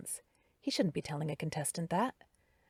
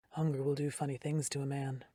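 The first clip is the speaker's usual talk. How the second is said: a hint of a lower, rougher man; low and softer, strangely calm; rough deep voice; 4 semitones lower, 4 dB quieter than usual. A narrator's reading. Darren asking Carrie about the contest, lightly lowered rough voice. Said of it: "Rough, gravelly, and much deeper".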